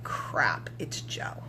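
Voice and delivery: a panicked aside under the breath; whisper. Retold or imitated. Host realizing his mentor is listening, whispered.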